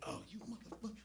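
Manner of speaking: high, very soft squeal; retold muffled cursing. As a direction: curse under the shock of cold snow. Retold reaction to snow down back.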